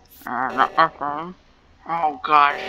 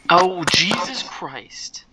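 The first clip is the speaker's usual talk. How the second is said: usual speaking voice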